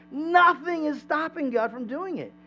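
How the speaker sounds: high, slow proclamation; rousing, insistent declaration; emphatic preaching. Real, not acted. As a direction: proclaim it with drawn-out weight on "Nothing". Preacher drives home the point.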